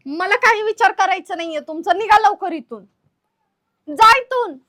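loud, high-pitched angry dismissal; angry order. Acted. Sister-in-law throwing out brother-in-law, angry. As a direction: build to a shout, driving him out. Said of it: "तुम्हाला एवढा राग आला होय".

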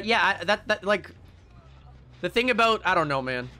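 normal talking voice

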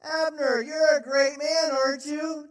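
loud, high, mocking taunt; David mocking Abner across the camp. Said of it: "David taunted".

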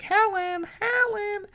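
mom yelling offstage. Acted. Missus Potts's mom calling her daughter offstage, yelling. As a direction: call out like a mother offstage. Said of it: "yells off stage".

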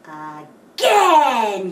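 loud eager shout; eager cry. Acted. Animals shouting for another ride. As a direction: cheer for another go. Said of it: "cry Cat and Dog".

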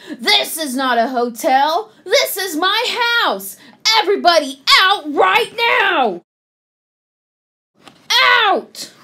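angry outburst building to a high shout; losing his temper. A narrator's reading. Bruce ordering guests out of his house, building to shouting. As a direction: start firm, then yell them out. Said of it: "Bruce lost his temper".

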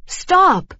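high, loud shout of "stop"; yell. A narrator's reading. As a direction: yell it out sharply like a waiter calling after runaways. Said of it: "the waiter yelled".

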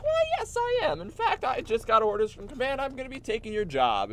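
lightly voiced smug new clone. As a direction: cheerfully announce you're taking his job. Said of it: "And he's like".

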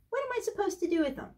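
mock objector, high and quick; imagined objection. Host mimics what you're thinking, high quick mock protest.

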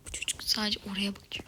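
quick, soft whisper while hiding; fast whisper. Real, not acted. Gamer hiding from an enemy, whispering quickly. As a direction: hush it fast so the enemy won't hear.

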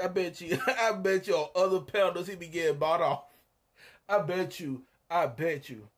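ordinary delivery

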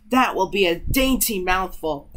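usual speaking voice

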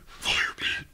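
whispered announcement; voiceless, hushed teaser; hushed reveal. Pretend. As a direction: whisper it like a secret attraction. Mock hushed reveal of activity.

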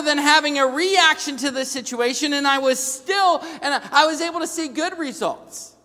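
high, loud preaching drive; preaching at full force. Acted. Pastor drives his point home to the congregation, loud and high. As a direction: preach it out to the pews.

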